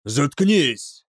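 rough, forceful jailer's order; barked. A narrator's reading. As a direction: bark it to silence the prisoner. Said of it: "рявкнул старший тюремщик". (рявкает)

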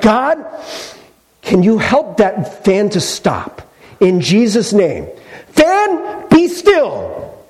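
high, earnest prayer commanding the fan; hammed-up prayer, rising to the command; mock pleading prayer. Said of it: "He's like".

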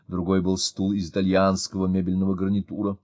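ordinary delivery

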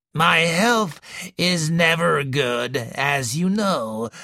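put-on slow, wheezy old woman's voice; slow wheezing invalid complaining to a visitor; wheezing old lady. A narrator's reading. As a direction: wheeze it out like a sickly matriarch. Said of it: "wheezed the old lady".

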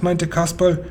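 plain reading voice

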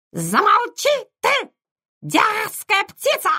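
very high, shrill parrot mimicking; squawking parrot. A narrator's reading. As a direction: screech it back like a parrot. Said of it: "закричал Попка".

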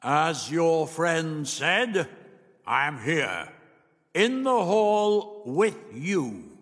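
high, very slow, drawn-out intoning; unseen booming being. A narrator's reading. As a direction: intone grandly, stretching each phrase. Plays the unseen voice.

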